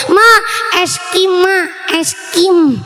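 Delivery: put-on child whining for ice cream, mum tugging; child begging mum for ice cream, whining. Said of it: "anak kecil minta sama ibunya merengek".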